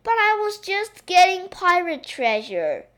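high, loud little princess complaint; pouting protest. A narrator's reading. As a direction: protest with a childish pout. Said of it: "pouted the little princess".

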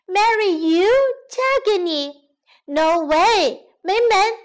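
put-on high, loud, scornful girl; loud refusal. Girl rejecting the lion's proposal, loud and scornful. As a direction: scoff loudly in a high girl's voice. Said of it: "女孩大声地说".

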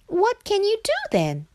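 high-pitched questioning frog; frog character. A narrator's reading. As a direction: ask in a high little frog voice.

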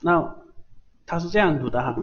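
usual speaking voice

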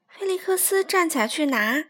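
ordinary delivery, nothing marked

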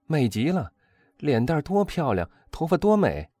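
high, loud, gushing praise of a beauty; rapturous admiration. Acted. Young man at the opera gushing over the countess, high and rapturous. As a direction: swoon over her face and hair, voice climbing.

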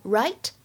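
"right" lifted upward as a question; rising intonation. Shown as a demonstration. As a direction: end on "right" rising, seeking agreement. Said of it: "会有一个语调的上扬". (“right”语调上扬，希望对方赞同)

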